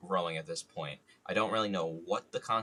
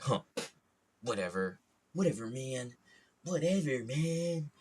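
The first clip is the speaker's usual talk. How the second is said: put-on teen voice, rising higher with each repeat; mock teenage brush-off; sulky teenager; 5 semitones higher than usual. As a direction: imitate a wronged, huffy teenager. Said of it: "like, you can kinda tell a teenager".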